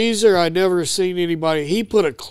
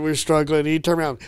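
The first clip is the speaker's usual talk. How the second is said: usual speaking voice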